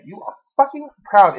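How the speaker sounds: rally them to be proud; rousing loud declaration; proud shout. Acted. Empowerment rant.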